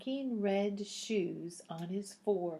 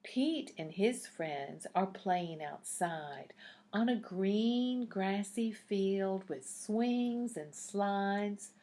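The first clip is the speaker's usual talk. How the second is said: usual speaking voice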